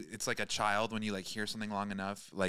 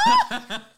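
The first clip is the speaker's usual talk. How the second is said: loud high Chewbacca yowl; Chewbacca roar; 13 dB louder than usual. Shown as a demonstration. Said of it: "you could do Chewbacca".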